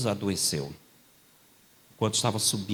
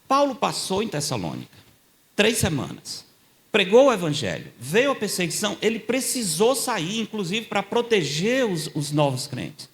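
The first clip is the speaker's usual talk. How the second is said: preaching; 8 semitones higher, 3 dB louder than usual. Acted. Preaching build-up, high pitch.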